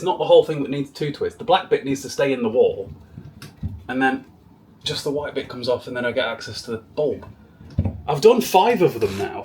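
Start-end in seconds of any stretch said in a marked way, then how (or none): none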